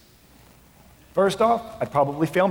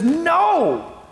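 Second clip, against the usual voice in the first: high, loud, impassioned plea; fervent preaching shout; 8 semitones higher, 3 dB louder than usual. Acted. Paul writing to the Galatians church, fervent loud plea. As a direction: proclaim it like Paul rebuking the church. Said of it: "and says".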